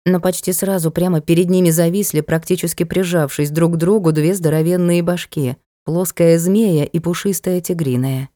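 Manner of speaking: plain reading voice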